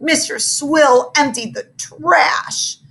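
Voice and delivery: high, slow wailing; girl wailing that the trash is gone, slow. Acted. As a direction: drag it out in a whiny wail. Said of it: "she wailed".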